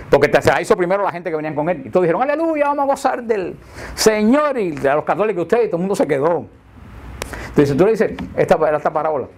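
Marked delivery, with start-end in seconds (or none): 2.2-5.6 mock fervent preacher. Burla de protestantes exaltados.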